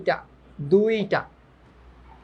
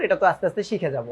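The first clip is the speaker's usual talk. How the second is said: ordinary talk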